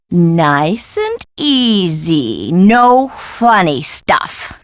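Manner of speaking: very slow, stretched-out caution; wary coaxing. Acted. Scholar directing something slipped under the door, warily slow. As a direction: coax it along word by word, partly whispered.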